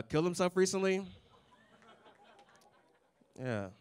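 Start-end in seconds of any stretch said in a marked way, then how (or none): none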